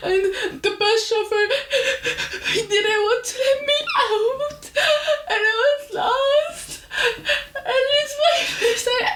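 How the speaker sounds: high, crying words broken by sobs; sobbing. Retold or imitated. Lost new student crying to the school secretary, high sobbing. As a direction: blubber it out through tears, laughing at herself. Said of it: "I'm sobbing".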